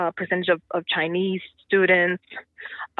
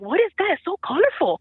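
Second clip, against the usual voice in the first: put-on much higher, wide-eyed kid voice; curious students; 9 semitones higher than usual. Teacher imitating students amazed by her colorful outfit, high and wide-eyed. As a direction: play an amazed student seeing bright clothes. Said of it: "they're like".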